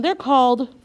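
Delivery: ordinary talk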